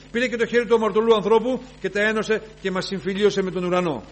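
loud, high proclamation of reconciliation; fervent preaching. Real, not acted. Preacher about reconciliation with heaven, rising fervent preaching. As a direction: build up to a cry of praise.

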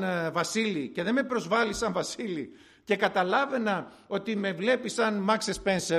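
normal talking voice

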